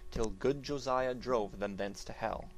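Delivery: plain reading voice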